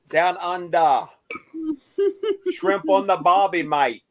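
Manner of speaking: mock Australian accent. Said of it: "thought he was from Australia".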